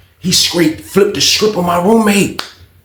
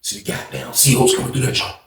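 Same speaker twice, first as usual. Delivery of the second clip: ordinary talk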